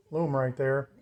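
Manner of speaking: normal talking voice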